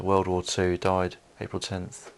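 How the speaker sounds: usual speaking voice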